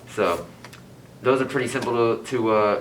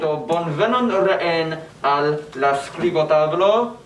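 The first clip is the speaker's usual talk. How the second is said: bright slow welcome, pitched higher; cheery welcome; 7 semitones higher, slower than usual. Real, not acted. Host welcoming viewers back in Esperanto, cheery and higher. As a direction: greet the viewers warmly and slowly.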